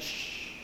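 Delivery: soft unvoiced shh; hushing shh. Moses hushing complaining people, soft shh. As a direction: hush them like noisy kids. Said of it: "He's like".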